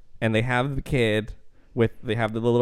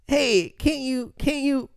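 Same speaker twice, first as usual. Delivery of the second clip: mock husband asking. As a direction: put on a wheedling husband voice. Husband voiced asking wife.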